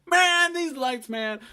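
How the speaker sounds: slow, high, loud mock rant; hammed-up method-actor outburst.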